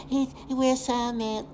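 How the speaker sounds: mocking coward. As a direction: stretch it out in a high, sneering imitation. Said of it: "This coward that's telling you".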